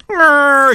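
high revving ambulance growl; siren growl. Acted. Girl racing the hurt cherry away, ambulance growl. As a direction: rumble like a toy ambulance.

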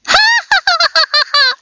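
very high squeaky giggle; tiny mice tittering; mouse laughter. Acted. The mice laughing at Simpkin outside, high squeaky giggle. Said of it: "The little mice only laughed".

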